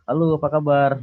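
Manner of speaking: playful Indonesian hello; mock cheery greeting. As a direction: greet a stranger brightly, half in jest. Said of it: "kok kita bilang".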